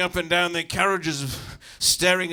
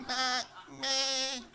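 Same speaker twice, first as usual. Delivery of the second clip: high, softer lamb bleat; terrified lamb; 8 semitones higher, 5 dB quieter than usual. Retold or imitated. Frightened lamb answering its parent, high soft bleat. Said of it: "he sounded like a terrified lamb".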